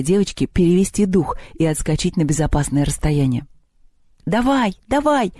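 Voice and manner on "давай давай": high-pitched urging cheer; cheering cry. A narrator's reading. Girl cheering from the basketball hoop, excited cry. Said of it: "закричала из баскетбольной корзины Лёля".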